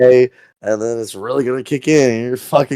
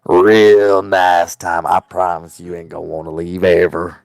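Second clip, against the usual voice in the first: creepy voice; 4 semitones lower than usual. Shown as a demonstration. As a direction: lure them in like a creep, dragging the words out. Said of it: "put on your creeper voice".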